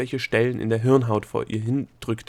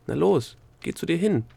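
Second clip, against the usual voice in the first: plain reading voice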